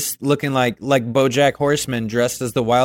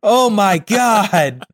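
laughing disbelief; 7 semitones higher, 4 dB louder, much slower than usual. Real, not acted. Laughing at the joke.

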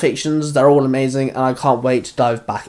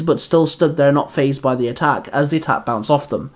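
usual speaking voice

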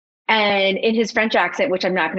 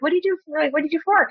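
curious question; 7 semitones higher, much faster than usual. Retold or imitated. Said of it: "I actually asked Eric".

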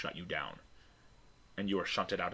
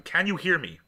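louder, higher demand for a response; raised, insistent voice; insistent repeated call; 8 semitones higher, 6 dB louder than usual. Acted. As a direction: press the question on an unresponsive subject. Scientist repeats question to machine.

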